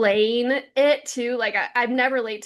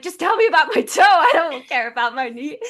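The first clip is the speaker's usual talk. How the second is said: higher and louder, urgently pleading; impatient plea; 5 semitones higher, 6 dB louder than usual. Retold or imitated. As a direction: push past the small talk, desperate for news. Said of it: "And I was like".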